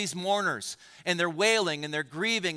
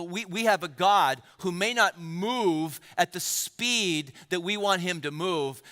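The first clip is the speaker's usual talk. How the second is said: ordinary delivery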